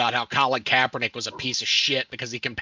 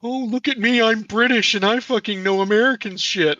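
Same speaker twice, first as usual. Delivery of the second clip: put-on British sneer, pitched up; mocking John Oliver; 8 semitones higher than usual. Mocking the British TV host who lectures Americans, higher mock British voice. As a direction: poke fun at a smug British host. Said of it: "take that up with John Oliver".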